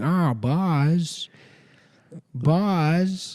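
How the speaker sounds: high, off-target catchphrase, repeated insistently; mock an overconfident impressionist, pitched high; bad Tom Hanks. Comic imitating a friend who keeps going, high botched impression. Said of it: "He keeps going".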